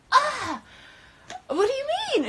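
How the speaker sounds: very high, loud mock wail; high-pitched protesting cry; retold exasperated whine. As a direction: squeal it in acted disbelief. Acted reaction to roommate.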